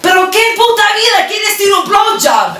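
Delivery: high screamed accusation in Spanish; furious shout. Retold or imitated. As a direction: scream it at the daughter. Mom repeating screamed question.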